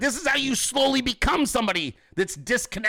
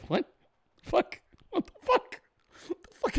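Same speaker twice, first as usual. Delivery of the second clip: high squeaky half-whispered cursing; baffled, voice almost gone; flabbergasted disbelief; 13 semitones higher than usual. Acted. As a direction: sputter it in a tiny strangled voice. Baffled reaction to being accused.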